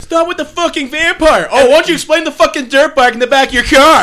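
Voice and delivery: high-pitched, incredulous mock rant; exasperated mom. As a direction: pile on questions like a fed-up parent. Joins in the mom's angry rant.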